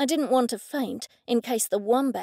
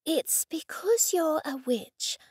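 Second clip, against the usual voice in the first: Laura's voice; 5 semitones higher, slower than usual. A narrator's reading. Laura's voice, slow.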